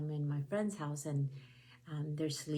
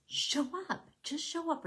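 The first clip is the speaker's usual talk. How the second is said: high, pushy order from someone else; bossy command; 12 semitones higher than usual. Retold or imitated. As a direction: bark it like a demanding boss. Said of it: "just somebody says".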